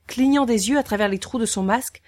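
usual speaking voice

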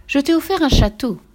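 high, loud motherly correction; correcting mother. A narrator's reading. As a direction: correct the child emphatically. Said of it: "rectifia sa mère". (mère qui rectifie avec insistance)

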